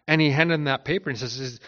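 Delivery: ordinary talk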